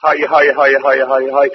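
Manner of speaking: low, soft sorrowful wail; mourning wail, low and soft; wailing lament. Acted. Lament cry in sermon.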